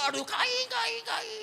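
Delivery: very high, loud imitated yelps; yelping kicked dog. Dog kicked by angry child, yelping. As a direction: yelp like a hurt dog, hammed-up. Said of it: "Ditendanglah anjing".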